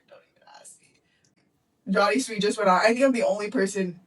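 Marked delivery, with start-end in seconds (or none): 0.1-1.9 slow whispered aside; whispered, slow aside, half to herself. Shown as a demonstration. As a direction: drop to a secret whisper. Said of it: "Don't even ask me how much".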